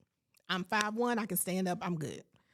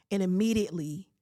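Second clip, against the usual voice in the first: ordinary delivery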